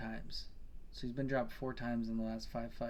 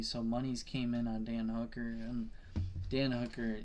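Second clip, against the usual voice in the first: ordinary talk, nothing marked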